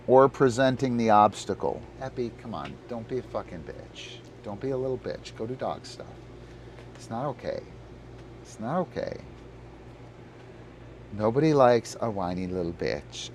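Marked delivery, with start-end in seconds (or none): 2.0-13.3 muttered scolding. Real, not acted. Owner muttering at his dog to behave. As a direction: scold him quietly under your breath.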